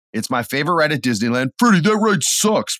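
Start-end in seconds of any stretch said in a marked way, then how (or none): 1.6-2.8 mock critic voice. Mocking critics of his ride.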